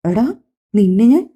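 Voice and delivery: loud furious threat; threatening. Acted. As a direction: lunge at him in rage. Furious threat, grabbing collar. (ഉച്ചത്തിൽ ദേഷ്യത്തോടെ ഭീഷണി)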